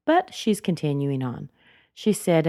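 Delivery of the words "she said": usual speaking voice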